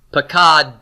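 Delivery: "Pakad" with a separate released t after d; unvowelled final cluster. Shown as a demonstration. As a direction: model "Pakad" as pa-KADT, no final vowel. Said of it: "we're not gonna vocalize either".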